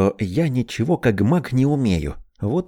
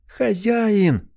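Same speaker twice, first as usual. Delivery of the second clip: high, happy exclamation; joyful cry; 10 semitones higher than usual. A narrator's reading. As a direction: call out with delight. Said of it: "радостно выкрикнула она".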